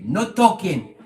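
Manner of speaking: deep mock Eastern European accent, loud. A voice in the jealous person's head, in a mock Eastern European accent. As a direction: bark it like a stern Eastern European guard. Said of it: "in a deep European, Eastern European accent".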